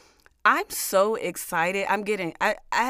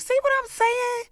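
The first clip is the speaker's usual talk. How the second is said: squealed high; 5 dB louder than usual. Acted. Mock shout at devil.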